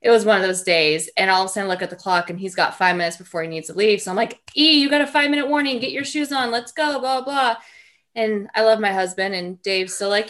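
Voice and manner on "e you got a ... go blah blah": loud, fast, high rush-out-the-door call; a mom hurrying her son to put his shoes on, loud and fast; hurried mom call. Retold or imitated. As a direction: hustle the kid out the door, urgently.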